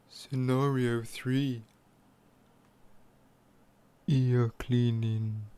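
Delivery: slow, low hushed title; soft spa whisper. Acted. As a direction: ease the title out slowly. ASMR title.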